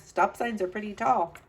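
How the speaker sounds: usual speaking voice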